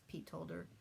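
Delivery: ordinary delivery, nothing marked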